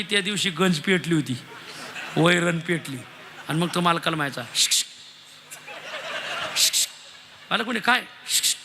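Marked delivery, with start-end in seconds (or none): 4.5-8.6 unvoiced shk shk to catch attention; hissing for attention. Servant hisses shk shk at the master to get his attention.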